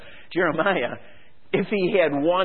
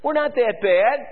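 complacent people; 8 semitones higher than usual. Retold or imitated. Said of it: "the people were thinking".

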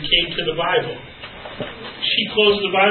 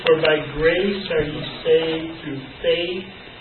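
slow, softer reading of the verse; scripture reading; 3 semitones lower, 4 dB quieter than usual. Acted. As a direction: read it reverently, slowly.

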